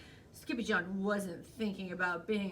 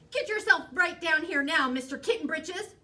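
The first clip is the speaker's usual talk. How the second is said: loud, high-pitched motherly command; cross mama laying down the law; stern ordering mother; 7 semitones higher, 10 dB louder than usual. A narrator's reading. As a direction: order a naughty kitten about, voice raised. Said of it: "ordered Mama Junebug Jones".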